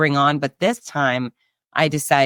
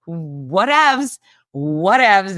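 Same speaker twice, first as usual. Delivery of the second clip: breezy whatevs; 3 semitones higher, much slower than usual. Real, not acted. Brushing off bronchitis.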